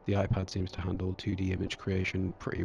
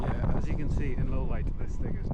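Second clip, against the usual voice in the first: raised voice over pouring rain; shouting over wind; 4 semitones higher, 6 dB louder than usual. Real, not acted. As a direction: call it out loud against the wind. Outdoors in wind and heavy rain.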